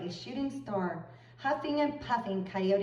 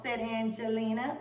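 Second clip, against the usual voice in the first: plain reading voice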